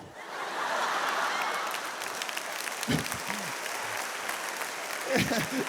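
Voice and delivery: breathy burst of real laughter; a long unvoiced laugh. Real, not acted. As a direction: laugh out at your own story. Laughs at own story.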